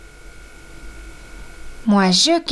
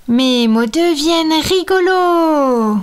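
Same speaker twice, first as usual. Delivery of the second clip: higher, louder playful silly voice; funny voice; 4 semitones higher, 5 dB louder than usual. Acted. Mermaid after her spell, turning her words silly. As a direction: make the words sound funny.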